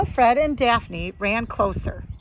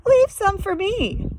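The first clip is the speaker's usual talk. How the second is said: Shaggy voice; 10 semitones higher than usual. Acted.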